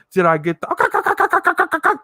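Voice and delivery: high rapid ka-ka-ka stutter; stuttering double-take noise. Retold or imitated. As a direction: mimic a glitching triple take.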